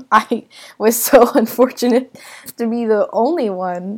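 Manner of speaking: words breaking into real laughter; laughing. Real, not acted. Storyteller bemoaning being the only one, laughing as she talks. Laughing at own bad luck.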